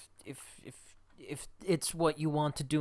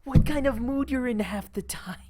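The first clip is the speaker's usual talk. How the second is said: high, loud complaint; exasperated outburst; 9 semitones higher, 7 dB louder than usual. Acted. Crewmate complaining about her moods, loud exasperation. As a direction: keep protesting at high pitch and volume.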